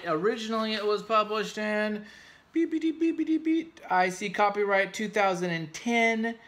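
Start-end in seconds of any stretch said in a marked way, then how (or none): 2.5-3.8 high mock beeps covering a name; playful censor beeps, much higher; vocal censor beep. Bleeps out the publisher.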